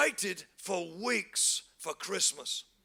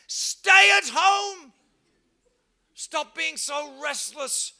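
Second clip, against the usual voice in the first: slow, much higher and louder rebuke; shout; 5 semitones higher, 7 dB louder, much slower than usual. Real, not acted. Preacher scolding restless shoppers, loud slow rebuke. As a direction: thunder it out.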